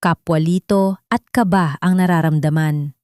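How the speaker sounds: ordinary delivery, nothing marked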